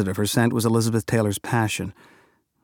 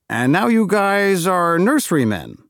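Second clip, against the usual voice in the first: hearty and slow, pitched higher; forced cheer at an awkward reunion; over-jovial; 8 semitones higher, 5 dB louder, slower than usual. A narrator's reading. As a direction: overdo the cheer a little. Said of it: "Still a little over-jovial".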